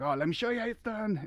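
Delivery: lightly imitating the Polish pole vaulter's accent; playful Polish accent impression; mock accent. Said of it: "he had an accent".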